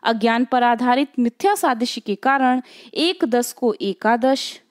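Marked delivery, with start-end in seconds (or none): 2.9-4.6 slow, deliberate example. Shown as a demonstration. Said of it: "इसको देखते चलिए".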